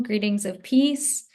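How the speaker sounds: ordinary talk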